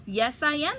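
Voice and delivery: loud, high, eager insistence; the stranger swears he is a duck; stranger insisting. Acted. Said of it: "says the stranger".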